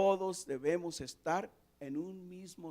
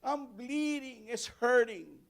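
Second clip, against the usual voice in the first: put-on high, loud cry of a hurt little girl; little daughter running to her father, crying out in pain; hurt child calling; 8 semitones higher, 18 dB louder, slower than usual. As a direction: call for daddy like a child who cut herself. Y viene y dice.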